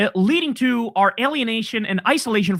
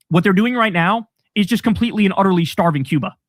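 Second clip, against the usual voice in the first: ordinary talk, nothing marked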